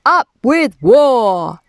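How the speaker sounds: slow, loud war chant; drawn-out tyrannosaur shout. A narrator's reading. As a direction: shout it out like a rallying cry. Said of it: "And they shouted".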